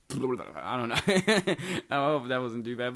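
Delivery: breaking into laughter; laughing. Real, not acted. Telling friends the toilet story, laughing it off. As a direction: laugh through the words, real giggle.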